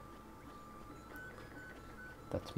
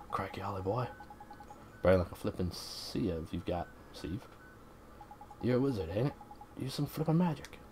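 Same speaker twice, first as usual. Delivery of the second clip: lightly voiced cheeky Welsh fairy; Drippy's Welsh-ish voice. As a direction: banter in a playful Welsh lilt.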